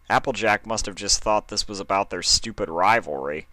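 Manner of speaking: plain reading voice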